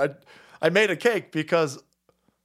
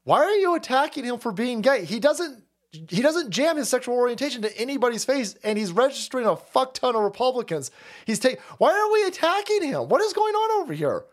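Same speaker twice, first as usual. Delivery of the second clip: retold outburst at Stu Peters; 7 semitones higher than usual. Venting at Stu Peters for attacking Scott, indignant high rant. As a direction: demand answers, voice pitched way up. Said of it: "and I was like".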